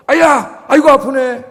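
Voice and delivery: loud, high mock ouch; hammed-up little cry of pain; acted pain. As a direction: yelp as if just pinched. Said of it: "야, 나 좀 꼬집어봐".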